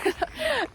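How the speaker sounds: a loud high burst of laughter. Real, not acted. Joking with Kiara about shoe sizes, high laugh.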